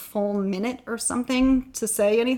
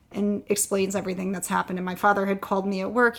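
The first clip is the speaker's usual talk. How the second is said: ordinary delivery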